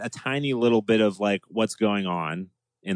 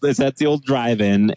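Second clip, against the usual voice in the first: normal talking voice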